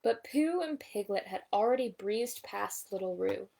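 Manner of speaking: ordinary delivery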